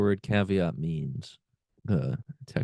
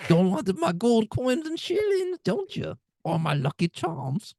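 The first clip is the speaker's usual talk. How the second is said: high, slow mock-Irish brogue; Irish leprechaun; 14 semitones higher, slower than usual. Co-host playing up his leprechaun bit, high-pitched Irish accent. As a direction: draw it out as a cartoon Irish leprechaun. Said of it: "it's a really good leprechaun".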